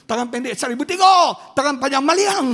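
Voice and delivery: loud, forceful street-seller cry; loud Padang talker. Retold or imitated. Pastor shows how Padang people talk, loud. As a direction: belt it out like a Padang speaker. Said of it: "orang Padang kalau ngomong kan suara kenceng".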